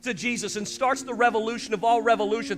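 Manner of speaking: rising to a loud high proclamation; building preaching. Acted. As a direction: lift the congregation toward purpose.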